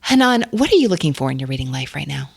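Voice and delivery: ordinary talk, nothing marked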